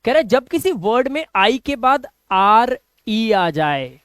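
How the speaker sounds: usual speaking voice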